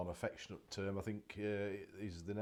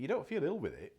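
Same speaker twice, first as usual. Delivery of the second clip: ordinary talk, nothing marked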